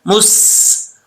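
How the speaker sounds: मुस with the सीन hissed, whistling; whistled seen. Shown as a demonstration. Teacher demonstrates whistling सीन, hissed. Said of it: "सीटी के साथ पढ़ी जाएगी".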